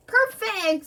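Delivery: high, loud exclamation; excited big-shot approval; delighted. Acted. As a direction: seize on the idea with glee. Film big shot delighted by idea.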